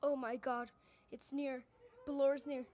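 normal talking voice

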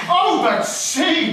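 high, slow rising "Oh, but see," with a lift on "Oh"; "see" stretched up high in delight; excited. Acted. To his friends, gleeful over the foolish letter, slow and high.

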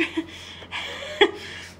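real amused laugh; short burst of breathy laughter. Real, not acted. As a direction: laugh at your own slip. Laughs at own swearing yesterday.

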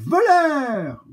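high shrill cat cry of thief; cat shouting. Acted. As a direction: yell thief after the crow. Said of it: "crie alors un chat".

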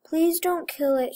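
plain reading voice

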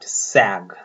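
सैग said slowly as sag; model pronunciation. Shown as a demonstration. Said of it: "pronounce करें". (सैग का धीमा साफ़ उच्चारण)

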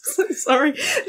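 laughing. Real, not acted. Apologizing while laughing.

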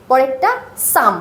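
ordinary talk

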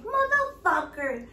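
playful Irish-accent old-man impression. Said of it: "he had this thick an-, accent".

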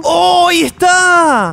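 high, drawn-out cry of discovery; excited discovery. Real, not acted. Player finally spots the hidden telescope, excited. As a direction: call it out high and long, thrilled.

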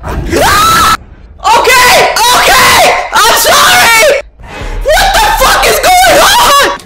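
very high, frightened shouting; panicked shouting. Real, not acted. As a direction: yell in panic. Screaming in fright after scare.

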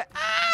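very high-pitched play scream; sudden squealed "ah"; high yelp. Pretend. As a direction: yelp as if hit in a game. Hit during play fight.

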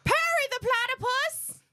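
high nasal villain voice; Doofenshmirtz voice. Pretend. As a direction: squeal it high. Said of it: "I can do that one".

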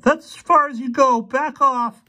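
put-on very high, loud teenage yell. A narrator's reading. Armed boy warns two intruders away, yelling. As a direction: shout a high-pitched warning. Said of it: "he yelled".

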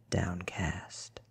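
ordinary delivery, nothing marked

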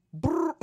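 high rapper brr noise; squealed brr. Retold or imitated. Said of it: "just does that noise".

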